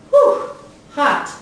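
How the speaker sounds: high loud "Whew, hot" like burnt by heat; hot breath demo. Shown as a demonstration. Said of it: "can you say".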